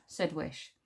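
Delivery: ordinary delivery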